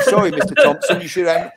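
giggling sorry that isn't sorry; laughing apology. Retold or imitated. Harry laughing at the Maidstone chairman while hanging up on him.

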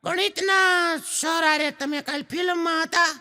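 put-on high cheeky kid, half laughing; child voice. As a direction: tattle like a naughty boy. Schoolboy tells on teacher. (શિક્ષકની પોલ ખોલતો છોકરો, ઊંચા અવાજે હસતાં)

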